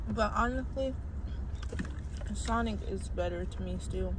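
chewing while talking; words muffled by food; mouth full. Real, not acted. Seen 0: puts food in mouth at -3; lips pressed, jaw working through 3.4.

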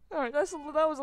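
loud, high laughing admission. Real, not acted. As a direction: laugh through the words. Laughing at own misfortune.

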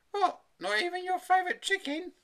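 high, loud mum question; incredulous mother. A narrator's reading. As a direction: ask in high-pitched disbelief. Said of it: "asked his mother".

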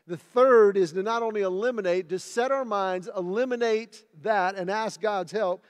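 loud, high driving sermon voice; full-force preaching. Acted. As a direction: preach it out to the room. Preaches loudly to congregation.

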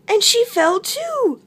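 high, dismayed exclamation; squeaked. A narrator's reading. Narrator telling of mouse's fall, high squeaky cry. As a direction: raise it to a squeak. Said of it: "squeaked Mouse".

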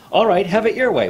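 high, loud angry snap. A narrator's reading. Waterbug furious at the silent trapped water, shouting. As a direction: lash out in anger. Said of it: "Waterbug said angrily".

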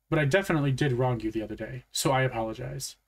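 ordinary delivery, nothing marked

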